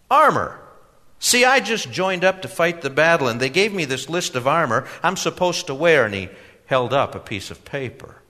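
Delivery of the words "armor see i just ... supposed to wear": put-on high, loud eager young man; earnest young customer. A narrator's reading.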